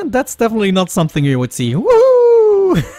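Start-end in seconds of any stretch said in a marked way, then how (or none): 1.7-2.8 high, loud whoop of delight; excited cheer. Real, not acted. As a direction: whoop it out with real joy. Cheering at the game.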